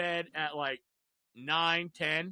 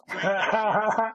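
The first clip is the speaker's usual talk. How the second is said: laughing; 3 semitones higher, 9 dB louder, much slower than usual. Real, not acted. Laughing at chugging the drink.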